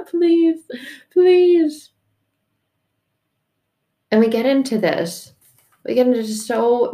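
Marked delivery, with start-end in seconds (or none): 0.1-1.9 laughing plea. Acted. Pleading through laughter.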